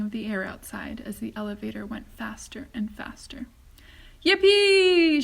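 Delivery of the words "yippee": loud, high whoop; an old man thrilled by the rocket ride; whooping with glee; joyful shout. A narrator's reading. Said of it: "shouted Grandpa Joe again".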